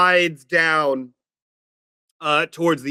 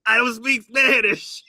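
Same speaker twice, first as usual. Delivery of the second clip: mock Spanish accent; 6 semitones higher than usual. Game master imitating a player's character, mock Spanish accent. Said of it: "With a Spanish accent".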